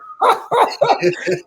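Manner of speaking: high-pitched burst of laughter; amused laugh. Real, not acted. Laughing at the guest's joke.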